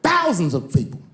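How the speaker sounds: usual speaking voice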